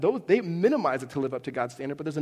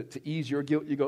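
ordinary talk, nothing marked

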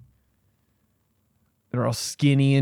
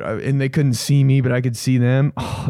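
usual speaking voice

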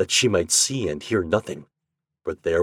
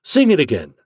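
high, loud call for more; eager, raised-pitch request; eager demand; 6 semitones higher, 6 dB louder than usual. Acted. Listeners demand the song again.

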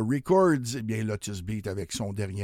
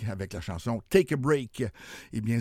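normal talking voice

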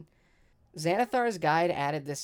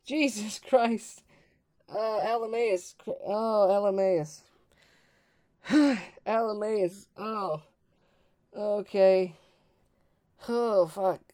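high, loud gasps, shaken after a nightmarish death; waking up screaming; 6 semitones higher, 4 dB louder, slower than usual. Acted. Player's character waking as if just on fire, panicked. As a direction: gasp and cry out in high-pitched shock. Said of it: "screaming as if you were just on fire".

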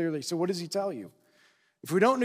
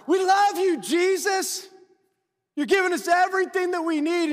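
much higher, louder gushing fan; adoring crowd; 11 semitones higher, 4 dB louder than usual. Retold or imitated. Crowd gushes over Jesus who fed them, high and excited. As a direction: gush like a thrilled crowd.

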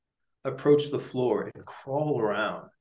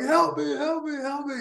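much higher mimicked cries for help; mock screaming; 13 semitones higher than usual. Host mimicking what neighbors might hear, high mock scream. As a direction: imitate frantic cries in a high voice, without shouting. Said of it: "This guy's screaming".

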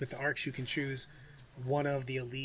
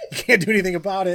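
louder, fast taunting jab; 5 semitones higher, 13 dB louder, faster than usual. Retold or imitated. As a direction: rub it in mockingly. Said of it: "You're like".

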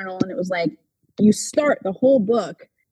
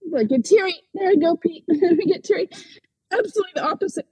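real teary voice, pitched high; tearing up, with a little laugh through it; choked up; 7 semitones higher than usual. Real, not acted. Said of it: "Sorry, I get choked up".

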